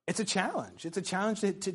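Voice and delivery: ordinary talk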